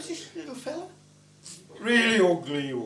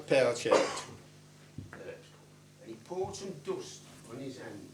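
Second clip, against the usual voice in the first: plain reading voice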